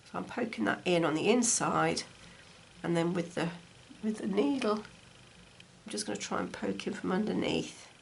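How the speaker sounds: usual speaking voice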